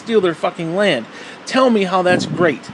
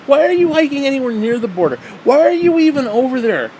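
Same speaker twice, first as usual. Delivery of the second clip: exasperated rant; 7 semitones higher, 4 dB louder than usual. Real, not acted. Incredulous rant at hikers.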